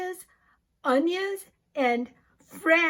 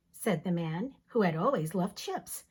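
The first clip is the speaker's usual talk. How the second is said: plain reading voice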